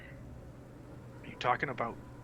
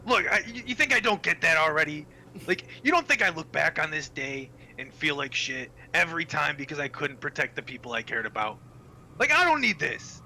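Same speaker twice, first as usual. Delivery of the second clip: louder, higher defiant anger; angry outburst; 6 semitones higher, 8 dB louder than usual. Pretend. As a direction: lash out at the gods. Rillian's outburst at the gods.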